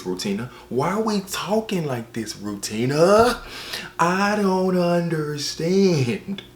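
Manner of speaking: comic wail of confusion; exasperated bafflement. Acted. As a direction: protest in rising disbelief, stretching the words. Exasperated at the sultry narration.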